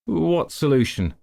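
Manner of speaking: a wary chief probing; suspicious. Acted. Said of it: "the chief asked suspiciously".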